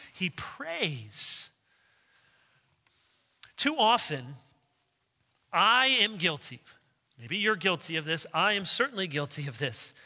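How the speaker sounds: normal talking voice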